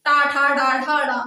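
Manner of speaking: "टा, ठा, डा, ठा, डा" as an even chant with long vowels; pronunciation drill. Shown as a demonstration. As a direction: recite "टा, ठा, डा, ठा, डा" rhythmically for the class. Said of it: "बोलिएगा उच्चारण कीजिएगा हमारे साथ". ("टा, ठा, डा, ठा, डा" लय में, स्वर खींचकर उच्चारण)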